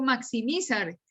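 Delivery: "maximizar" stressed on MI, the next-to-last syllable; misplaced stress. Shown as a demonstration. Teacher showing how we don't say it, "maximizar" stressed on MI. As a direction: shift the stress in "maximizar" back to MI. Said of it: "yo no digo".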